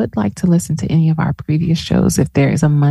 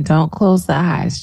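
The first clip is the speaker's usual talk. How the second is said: ordinary delivery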